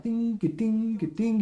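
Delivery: high clinking ding ga ding; imitated armor clinking. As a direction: mimic chainmail jingling. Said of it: "you can, like, hear".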